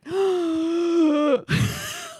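retold frightened gasp; imitated startled gasp; sharp gasp. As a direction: gasp as if just grabbed. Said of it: "And I'm like".

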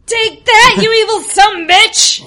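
high, full-voiced fighting yell; defiant battle shout. Greg strikes the monster, shouting in defiance. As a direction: hurl it at the enemy.

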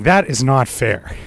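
ordinary delivery

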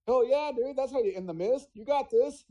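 mock-cheer like an excited buddy; excited Stephen King. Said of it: "Stephen King's like".

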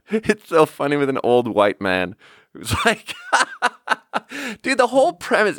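talking through high-pitched laughter; real laughing. Real, not acted. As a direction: crack up while trying to explain the joke. Cracking up over the TV quote.

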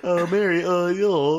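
hammed-up awkward dude; mocking an awkward greeting. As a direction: tease a friend's clumsy hello.

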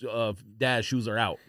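normal talking voice

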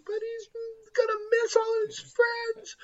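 put-on high, wobbly child sob; very high teary little-girl voice; crying child. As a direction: imitate his daughter crying that the child has to leave. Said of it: "And she's crying".

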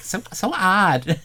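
high, slow and amused; salon lady voice. Retold or imitated. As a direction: drawl it out in her high voice.

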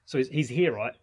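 ordinary delivery

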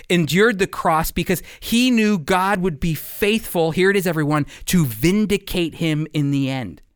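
ordinary talk

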